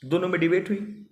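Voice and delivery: ordinary talk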